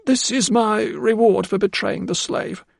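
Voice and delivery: high, slow guilty confession; a remorseful maid owning her betrayal; confessing housekeeper. A narrator's reading.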